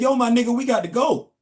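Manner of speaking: high, loud casual call; imitating coworker. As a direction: mimic his buddy calling out easy and loud. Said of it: "That's how he said it".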